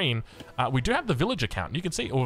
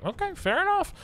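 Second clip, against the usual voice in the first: mock resigned. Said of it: "I was like".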